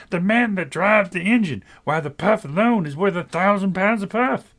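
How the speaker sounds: loud, high crowd voice; chorus of voices. A narrator's reading. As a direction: call it out like a chattering crowd.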